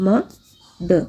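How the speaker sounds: "ma, the" as two separate beats; mother said syllable by syllable; split into syllables. Shown as a demonstration. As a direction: break mother into "ma" and "the". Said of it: "is said to have two syllables".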